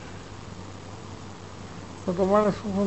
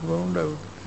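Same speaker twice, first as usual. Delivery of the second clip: "બહુ ઊંડા ઉતરે છે" said much softer, fading to a murmur; hushed echo; 11 dB quieter than usual. Retold or imitated. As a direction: let the rumour die away, soft as an echo. Rumour repeated, fading away. (ધીમા, ઓસરતા અવાજે "બહુ ઊંડા ઉતરે છે")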